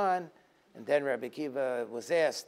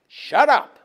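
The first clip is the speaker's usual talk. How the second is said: shouted command; 11 semitones higher, 15 dB louder than usual. Shown as a demonstration. God silencing Moshe's question, a shouted Shut up. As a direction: Shut up shouted, much higher and louder. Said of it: "Shtok" means".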